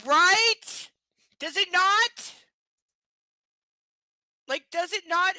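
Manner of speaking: high, loud plea for agreement; incredulous outrage. Real, not acted. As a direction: demand the listener agree. Exaggerated outrage.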